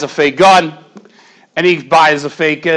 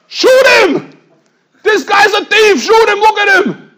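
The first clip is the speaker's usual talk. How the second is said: frantic shouting; 4 dB louder, slower than usual. Retold or imitated. Thief urges police to shoot.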